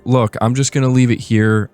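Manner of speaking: ordinary talk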